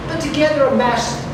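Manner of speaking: ordinary delivery, nothing marked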